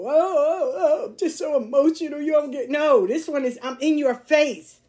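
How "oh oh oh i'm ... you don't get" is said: whiny fake-sob voice; mock crying. Mocking emotional people with a whiny fake cry. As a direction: mimic someone weeping over their feelings.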